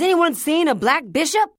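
loud high frantic call; shouting. Acted. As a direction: call out desperately to the street.

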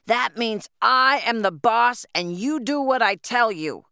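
loud, high, bullying shout; roaring boss. A narrator's reading. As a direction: bellow orders at a crowd of orphans. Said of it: "Roaring and cursing".

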